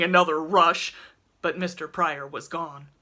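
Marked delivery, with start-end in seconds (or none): none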